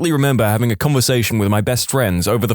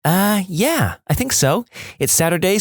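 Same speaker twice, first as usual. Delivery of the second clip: put-on high-pitched girl on the phone; light, high phone-call girl; girl I liked; 8 semitones higher than usual. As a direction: voice a girl higher, casual and agreeable. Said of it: "Girl I liked".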